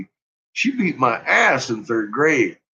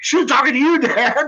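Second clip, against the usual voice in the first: son's protest; 9 semitones higher than usual. Retold or imitated. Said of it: "I was like".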